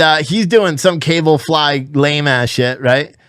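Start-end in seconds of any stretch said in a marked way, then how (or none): none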